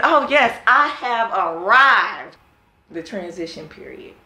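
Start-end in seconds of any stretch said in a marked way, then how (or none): 0.0-2.4 loud, high, triumphant declaration, drawn out at the end; self-satisfied boast. Retold or imitated. Young achiever congratulating herself on her success, grandly boastful. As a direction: proclaim it grandly, savoring the last words.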